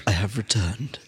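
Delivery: a hint of menacing villain; creepy villain voice.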